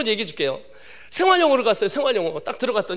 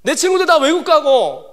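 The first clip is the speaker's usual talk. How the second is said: high, hammed-up wailing prayer; acted bawling in bitter frustration; mock sobbing tirade, breaking into whimpers; crying complaint; 7 semitones higher, 4 dB louder than usual. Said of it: "막 이러고 울었어요".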